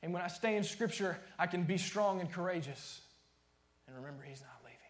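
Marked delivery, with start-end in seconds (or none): 3.8-4.8 whispered reminder; hushed whisper to the congregation. Real, not acted. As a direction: drop to a whisper for the last line.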